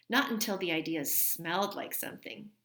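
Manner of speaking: usual speaking voice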